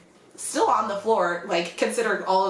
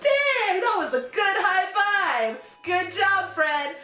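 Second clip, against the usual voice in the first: high-pitched, delighted exclamation; impressed praise; 9 semitones higher than usual. Retold or imitated. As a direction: react like you're genuinely impressed. Acted praise for hard high five.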